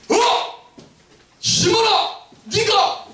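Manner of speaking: high, surprised exclamation; Jesus amazed at Simon's answer. Acted. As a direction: leap up in delighted surprise. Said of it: "예수님이 감탄하신 거예요".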